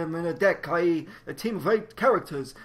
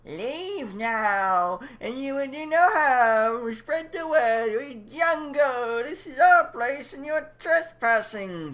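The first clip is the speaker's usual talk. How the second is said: slow menacing creature voice; 5 semitones higher, much slower than usual. Acted. Creature warning intruders.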